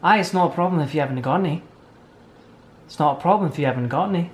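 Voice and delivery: fast, run-together mock-Scottish; quick, badly faked Scottish accent; attempted Scottish accent. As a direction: rattle it off in an amateur Scottish accent. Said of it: "best impression of a Scottish accent".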